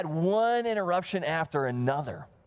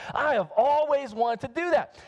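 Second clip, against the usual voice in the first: amused confession; 7 semitones higher than usual. Retold or imitated. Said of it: "he just starts laughing".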